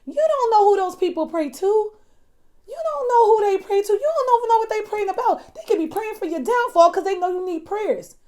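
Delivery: exasperated preacher's admonishment; impassioned warning. Real, not acted.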